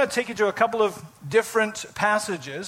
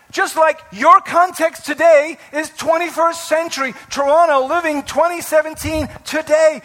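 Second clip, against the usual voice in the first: ordinary talk, nothing marked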